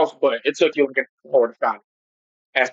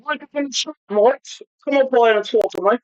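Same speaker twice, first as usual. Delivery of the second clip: bad English accent; 8 semitones higher, much slower than usual. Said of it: "My English accent sucks".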